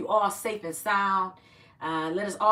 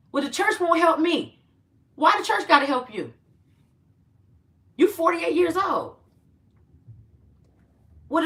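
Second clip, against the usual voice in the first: retold scolding; 8 semitones higher, 6 dB louder than usual. Acted phone quarrel with caller.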